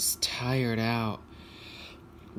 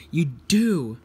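loud, insistent accusation; quarrelling retort; 4 semitones higher, 8 dB louder than usual. Acted. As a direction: fire it back loudly in a squabble. Quarrel, engines answer back.

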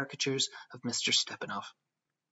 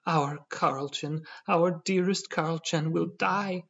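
slow, drawn-out wail rising higher; howling lament; 5 semitones higher, 3 dB louder, slower than usual. A narrator's reading. Wife howling over the dying crocodile, grief-stricken. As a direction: howl with grief, stretching the words. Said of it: "howled his wife".

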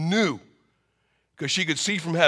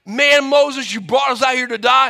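upset Israelites; 6 semitones higher, 5 dB louder than usual. Acted. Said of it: "everybody is so upset".

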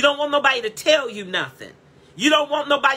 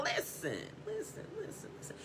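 sudden whisper; 9 semitones higher, 21 dB quieter than usual. Real, not acted. Preacher hushing the congregation in a whisper. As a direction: drop to a hushed whisper.

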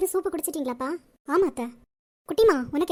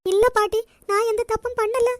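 tearful, high-pitched protest of innocence; crying; 6 semitones higher, 6 dB louder than usual. Acted. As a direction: sob it out to grandmother, loudly. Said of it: "முதல்ல அழுகையை நிப்பாட்டு".

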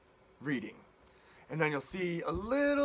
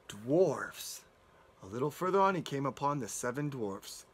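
plain reading voice

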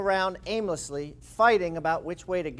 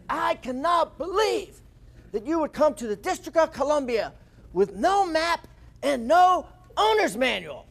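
put-on fuming old mechanic; 7 semitones higher than usual. Grumpy mechanic scolding two lost teens, high fuming disbelief. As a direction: play the grumpy mechanic at his wits' end, pitch climbing. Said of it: "you just start see him fuming".

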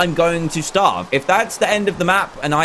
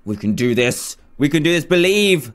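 usual speaking voice

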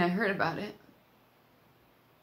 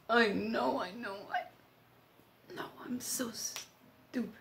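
breaking down; 3 semitones higher, 5 dB quieter, much slower than usual. Acted. Friend blames herself at Doug's bedside, breaking down. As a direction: voice giving out into slow whisper.

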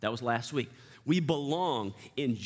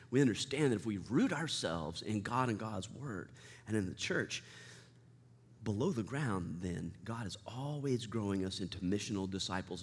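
quiet reassurance; 6 semitones lower, 6 dB quieter than usual. Real, not acted. Softening into reassurance.

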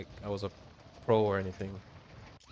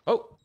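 high sharp yelp of surprise; surprised yelp; 12 semitones higher, 7 dB louder than usual. Real, not acted. Sudden firefight surprise.

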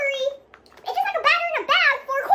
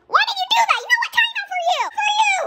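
high, indignant telling-off; scolding; 7 semitones higher than usual. Pretend. Puppet gives the new friend a time out, scolding. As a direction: scold in a raised, high-pitched voice.

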